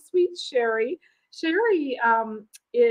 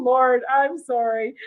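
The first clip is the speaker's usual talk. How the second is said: ordinary delivery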